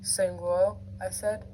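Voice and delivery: soft, low story reading; hushed story narration. A narrator's reading. Reader narrating a tense captive scene, hushed narration. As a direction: read it quietly and intimately. Reads fiction aloud.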